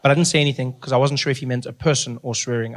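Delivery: normal talking voice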